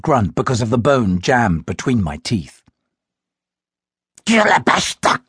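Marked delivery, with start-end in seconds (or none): 4.2-5.2 higher, sharper foreign-sounding snap; snapped command. A narrator's reading. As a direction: attacker snaps the strange words again at the boy, sharply. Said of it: "my attacker snapped again".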